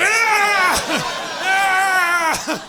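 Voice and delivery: loud, high, slow triumphant shout; champion cheer. Retold or imitated. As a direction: roar like a coach doused in Gatorade. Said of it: "like I was the coach getting the Gatorade bath".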